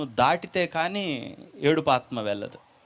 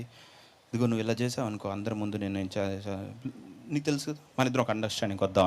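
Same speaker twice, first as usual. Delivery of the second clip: soft, lower, sheepish pleading, speeding up at the end; hushed bargaining; 7 semitones lower, 8 dB quieter than usual. Acted. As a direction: whisper a deal, embarrassed. Disciple bargains with demon.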